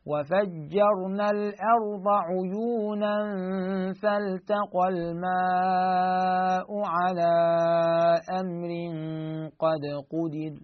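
slow, drawn-out chant; solemn tajweed reading; Quranic recitation. Shown as a demonstration. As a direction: recite the verse slowly, stretching the vowels. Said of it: "reads the verse as a demo".

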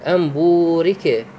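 بورك with the "uu" held long for two beats; elongated vowel. Shown as a demonstration. Teacher showing students the madd, the "uu" of بورك drawn out. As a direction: stretch the waw in بورك slowly. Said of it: "مقدار المد بورك بمقدار حركتين".

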